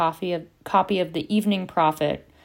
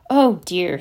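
mock dismay; 7 dB louder than usual. A narrator's reading. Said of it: "his face falling comically".

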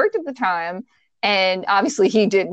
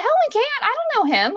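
very high, incredulous retort; retold indignant protest; faster than usual. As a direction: replay a heated comeback to a friend. Retold argument with friend.

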